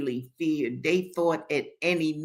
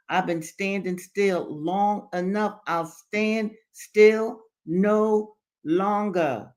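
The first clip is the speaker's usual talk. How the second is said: slow, weighted declaration, building at the end; defiant; 5 semitones higher, 5 dB louder, slower than usual. A narrator's reading. Henry refuses to wait any longer, slow and defiant. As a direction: draw out each word and push harder to the close. Henry defies the old man.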